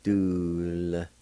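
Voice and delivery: DULSTA stretched out as "dool, s, ta"; syllable by syllable. Shown as a demonstration. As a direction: sound out "Dulsta" in separate pieces with a long L. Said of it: "pronounce the L sound ... like this".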